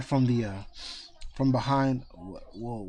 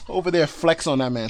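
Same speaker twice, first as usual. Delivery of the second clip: normal talking voice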